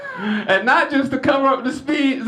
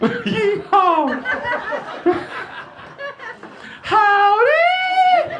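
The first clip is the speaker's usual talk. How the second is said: high, hollered hillbilly greeting; yelped high country howdy; Minnie Pearl impression; 12 semitones higher, much slower than usual. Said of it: "My Minnie Pearl impression".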